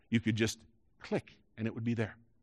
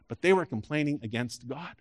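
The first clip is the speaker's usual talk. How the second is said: ordinary delivery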